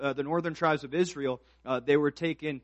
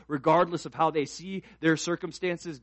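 ordinary delivery

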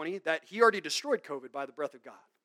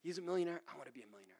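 much softer, longing mock follower; mock envious whisper; 12 dB quieter, faster than usual. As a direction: murmur it like a covetous fan. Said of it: "it tickles their ears".

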